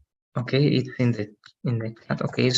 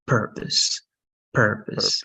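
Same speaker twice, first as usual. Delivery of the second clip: "purpose" with the stress on PUR, second syllable weak; stress on first syllable; 3 semitones lower, slower than usual. Shown as a demonstration. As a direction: model "purpose" slowly, leaning on the first syllable. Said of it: "the stress is over here".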